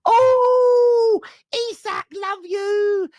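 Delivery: high-pitched, drawn-out mock swooning; fickle Newcastle fan. Newcastle fans welcoming back their striker, mock high-pitched adoration. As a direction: gush adoringly in a silly high voice. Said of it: "that's why I'm speaking like that".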